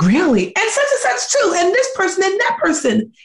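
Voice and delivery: very high, eager listing; light up as you realise; relieved excitement. Retold or imitated. Realising her friends are in debt too, high and eager. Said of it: "I was like".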